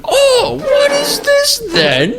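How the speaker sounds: very high, slow, squealed comic impression; badly hammed-up celebrity voice; Russell Brand. Game show guest reacting to the word, shrill mock impression. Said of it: "do a Russell Brand impression".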